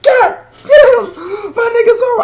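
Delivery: very high, loud squeal; hammed-up high fan wail; mock tearful fanboy. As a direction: squeal in overwhelmed awe, nearly crying. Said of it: "I'm about to cry".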